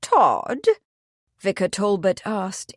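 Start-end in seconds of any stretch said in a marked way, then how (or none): 0.0-0.7 higher, swooping incredulous question. A narrator's reading. The vicar hears who is accused, incredulous. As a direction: ask it in disbelief, pitch swinging up.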